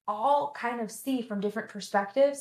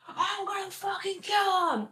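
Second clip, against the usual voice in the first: high-pitched mock scream of rage; hammed-up fury, drawn high; furious outburst, imitated; 10 semitones higher than usual. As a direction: act out an irate partner screaming, without full volume. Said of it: "Like, he was furious".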